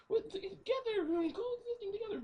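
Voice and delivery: very high squeaky falsetto plea; pleading little girl. Retold or imitated. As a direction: imitate a child begging in falsetto. Girl's plea continues.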